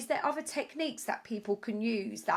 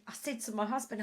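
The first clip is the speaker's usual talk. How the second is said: ordinary talk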